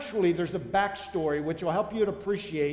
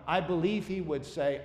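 ordinary delivery

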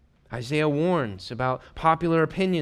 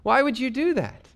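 incredulous; 11 semitones higher, 4 dB louder than usual. Acted. Preacher mocks consulting the dead.

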